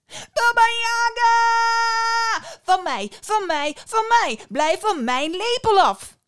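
very high, loud, long-drawn scream; outraged shout. Acted. Little boy screams at the witch over his spoon, outraged shout. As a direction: yell it possessively at full voice.

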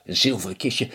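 plain reading voice

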